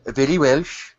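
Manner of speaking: brief put-on Welsh lilt; Welsh accent. Shown as a demonstration. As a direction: attempt a Welsh accent on request. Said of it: "do some Welsh, then".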